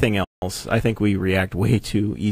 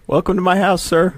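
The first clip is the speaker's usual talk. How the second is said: higher-pitched mock-polite greeting; mock welcome; 8 semitones higher than usual. Co-host playing the kid greeting police, mock politeness. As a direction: playfully act out a welcome. Jokingly voicing the caught kid.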